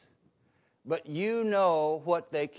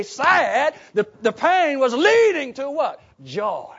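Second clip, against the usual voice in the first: louder, higher, rising preacher's cadence; fervent preaching; 6 semitones higher, 9 dB louder than usual. Acted. Pastor urging congregation that sorrow leads to joy, fervent preaching. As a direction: build the sermon to full force.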